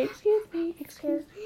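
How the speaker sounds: put-on high, small voice, a little girl excusing herself; squeaky polite apology. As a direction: pipe it up like a tiny polite girl. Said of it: "oh, say".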